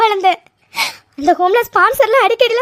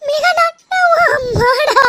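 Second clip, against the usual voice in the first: slow, higher, crying plea; tearful plea; 5 semitones higher, slower than usual. Acted. Mother pleads with her daughter, slow tearful plea. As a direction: beg her through tears, slowly.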